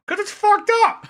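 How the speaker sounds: high, loud cartoonish outburst; exaggerated high-pitched punchline; excited mock shout. Acted exchange with death cult member.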